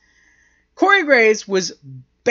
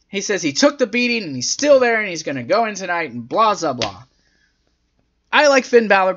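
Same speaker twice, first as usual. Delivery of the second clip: ordinary delivery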